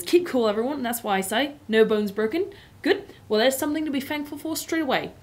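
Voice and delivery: firm, hearty cheer; hearty cabbie voice. Acted. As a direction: keep everyone's spirits up. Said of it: "firm, hearty voice".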